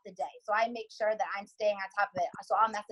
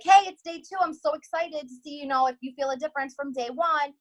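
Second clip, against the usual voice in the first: excited; 6 semitones higher, 4 dB louder than usual. Retold or imitated. Cheery follow-up message to customer.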